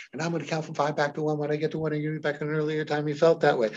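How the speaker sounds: rapid, soft count-back; fast. Shown as a demonstration. Hypnotist regressing a client, fast and soft. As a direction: rush through so the mind can't wander. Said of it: "You notice I'm so fast".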